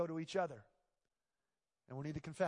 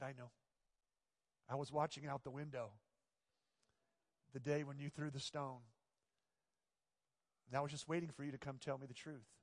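softer, lower, kindly grandmother; gentle grandmother; 3 semitones lower, 6 dB quieter than usual. Acted. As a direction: tell him lovingly that you knew. Grandmother gently reveals she knew.

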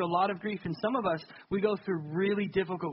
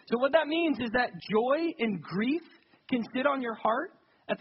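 raised, slowed, emphatic preaching; preaching at full force; 5 semitones higher than usual. Acted. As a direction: drive the point home to the congregation.

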